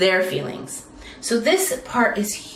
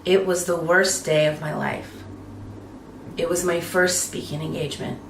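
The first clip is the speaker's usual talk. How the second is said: descending dark story; 5 semitones lower, 5 dB quieter than usual. Shown as a demonstration. Speaker demonstrating her dad's death story, low and somber. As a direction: drop the voice to tell a painful memory. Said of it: "I drop into a descending melody".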